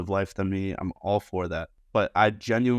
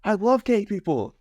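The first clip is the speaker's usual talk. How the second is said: high, insistent protest; mock overeager denial; 13 semitones higher, 5 dB louder than usual. Customer insisting to the bartender, high and insistent. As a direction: overcompensate loudly, pitched high.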